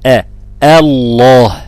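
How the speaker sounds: الله with a thin أ, then heavy lam, slow and loud; light hamza. Shown as a demonstration. Teacher models the hamza of God's name, light hamza in الله. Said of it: "الترقيق في همز لفظ الجلالة".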